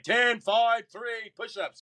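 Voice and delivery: mock drill instructor yelling a jumbled count. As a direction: bark the count like a drill instructor who can't count. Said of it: "but they can't count".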